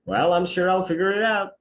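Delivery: upbeat confident reassurance. Shown as a demonstration. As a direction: sound sure you'll manage it. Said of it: "means that he is confident".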